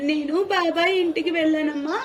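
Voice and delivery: high, loud emotional cry to her mother; emotional outburst. Acted. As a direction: burst out with feeling at the reunion.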